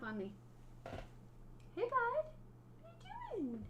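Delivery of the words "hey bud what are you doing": high cooing voice to the cat; pet talk. Real, not acted. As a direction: coo up high at the kitty. Talking sweetly to her cat.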